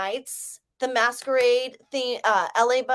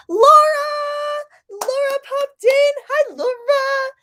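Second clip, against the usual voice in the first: excited greeting call; 7 dB louder than usual. Real, not acted. Calling out to arriving viewer.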